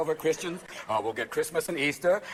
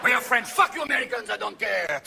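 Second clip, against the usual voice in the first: put-on French accent; 5 semitones higher than usual. Mocking French attitude.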